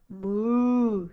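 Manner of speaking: a lowing moo in a different voice; cow moo. Acted. Reading to kids, the cow answers with a moo. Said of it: "said Cow".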